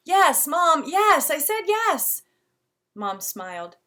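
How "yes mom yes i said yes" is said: exasperated. Acted. Exasperated boy repeats yes.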